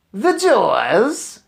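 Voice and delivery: a voice that fills the room and bounces off walls; Grand High Witch shout. A narrator's reading. Witch leader shouting to her assembled witches, booming. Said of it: "shouted the Grand High Witch in a voice that filled the room".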